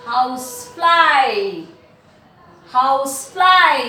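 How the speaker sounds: Housefly slow and loud, long eye ending; teacher projecting the eye sound in Housefly; stretched I sound. Shown as a demonstration. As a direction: draw out the fly in Housefly. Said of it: "I sound, no?".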